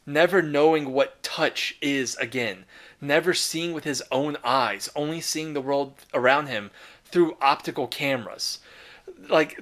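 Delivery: usual speaking voice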